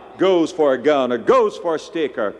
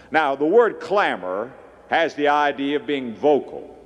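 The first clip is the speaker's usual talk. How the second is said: usual speaking voice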